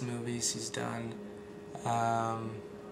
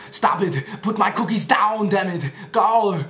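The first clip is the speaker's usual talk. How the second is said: much higher, louder, slow angry yell; Arnold shout; 8 semitones higher, 9 dB louder, slower than usual. Retold or imitated. Arnold yelling at cookie thief, loud yell. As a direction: bark like furious Arnold. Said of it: "those are my Arnold impressions".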